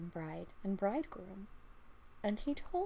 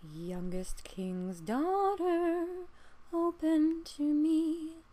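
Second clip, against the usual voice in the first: slow, high, whispery frog voice, much louder; a pleading frog drawing out each word; eerie whispered frog plea at the princess's door; frog calling at the door; 6 semitones higher, 5 dB louder, much slower than usual. A narrator's reading. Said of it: "knocking a second time and crying".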